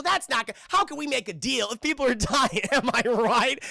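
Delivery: loud, hammed-up chummy schmoozer; coked-up smooth talker. Hostage sweet-talking John over the radio, loudly chummy. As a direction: act overconfident and buddy-buddy, laughing as you go. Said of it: "he's on so much cocaine".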